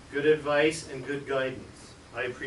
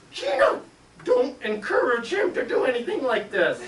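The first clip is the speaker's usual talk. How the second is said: high, louder exasperated cry; exasperated scold; 9 semitones higher, 5 dB louder than usual. Retold or imitated. Debbie scolding Gina for egging on John. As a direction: scold her, fed up.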